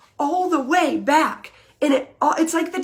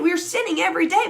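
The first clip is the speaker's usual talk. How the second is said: normal talking voice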